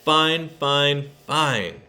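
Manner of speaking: joyful chanting cheer; cheering shout. A narrator's reading. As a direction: shout it like a happy crowd. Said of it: "fine teachers shout".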